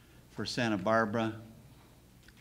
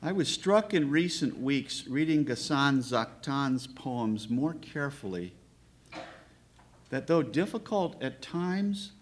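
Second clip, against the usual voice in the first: measured, raised oratorical reading with deliberate pauses; formal literary introduction; 4 semitones higher than usual. Real, not acted. Host introducing a Palestinian poet's work, formal and deliberate. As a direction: read a prepared tribute phrase by phrase, weighting key words.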